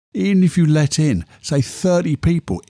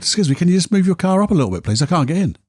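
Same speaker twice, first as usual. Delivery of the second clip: higher and fast, with a touch of tone; a bit of tone; 6 semitones higher, faster than usual. Retold or imitated. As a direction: rattle off the polite ask with a slight edge. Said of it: "a little bit of tone in their voice".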